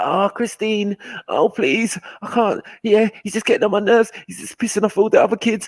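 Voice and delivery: put-on young mum complaining to Christine about her son, high. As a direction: play a frazzled young mum, high-pitched. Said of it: "They're like".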